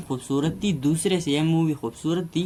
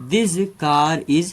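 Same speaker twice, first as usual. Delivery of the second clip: very slow, word by word example sentence; slow dictation; 3 dB louder, much slower than usual. Shown as a demonstration. Teacher dictating an example to students, very slow. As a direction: spell it out slowly for the class. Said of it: "ये one syllable वाले हैं।".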